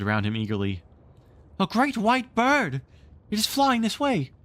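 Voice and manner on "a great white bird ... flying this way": put-on high, loud, awed boy's voice; a boy bursting with wonder; excited boy. Nibs describes bird eagerly.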